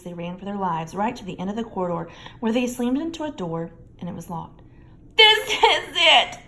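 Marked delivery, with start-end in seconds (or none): 5.2-6.4 high, loud, slow wail; moaning despair. A narrator's reading. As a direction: moan it, doomed. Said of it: "Ron moaned".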